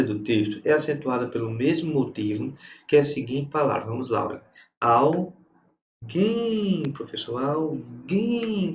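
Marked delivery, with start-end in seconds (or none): 4.8-6.8 Alguém broken in two, "guém" held high and long; Alguém with a long, raised "guém"; stress on the final syllable. Shown as a demonstration. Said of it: "Qual é a sílaba tônica". 7.4-8.7 alguém with "guém" stretched long; alguém sounded out, holding the stressed "guém"; stress on the final syllable. Shown as a demonstration. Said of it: "Qual é a sílaba tônica".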